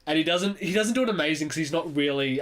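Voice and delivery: ordinary delivery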